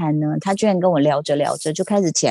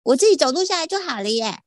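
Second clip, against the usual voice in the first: high, naive voice imitating a friend; mock a carefree friend, pitched way up; teasing high-voiced friend; 8 semitones higher than usual. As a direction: put on a high-pitched, innocent voice to mimic her. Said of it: "大发说".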